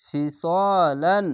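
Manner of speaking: "ফি স লান" with "স" held long; drawn-out vowel. Shown as a demonstration. Teacher showing alif lengthening, middle vowel drawn out. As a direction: stretch the vowel of "স" one alif. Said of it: "যাবার পাশে খালি আলিফ এক আলিফ টেনে পড়বেন".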